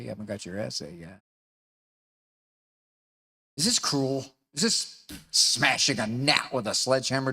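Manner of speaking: normal talking voice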